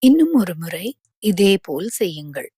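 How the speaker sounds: ordinary delivery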